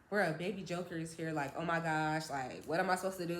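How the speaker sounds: low, soft retold freak-out; hushed alarm. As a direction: keep the alarm down, under your breath. Retelling panicked aside to friend.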